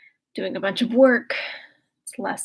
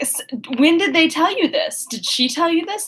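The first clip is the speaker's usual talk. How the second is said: shocked Eleanor; 7 semitones higher than usual. Retold or imitated. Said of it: "And Eleanor's like".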